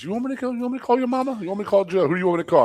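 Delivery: high, teasing threat to call mama; taunting coach threat. Retold or imitated. Coach threatening to call the player's mother, high mocking taunt. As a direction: dangle the phone call over the kid. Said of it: "he goes".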